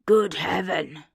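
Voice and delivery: put-on loud, amazed exclamation of old Scrooge; astonished cry. A narrator's reading. Scrooge recognizing his boyhood countryside, astonished loud cry. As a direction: burst out in wonder at the sight.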